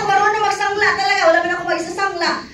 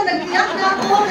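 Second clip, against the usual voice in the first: normal talking voice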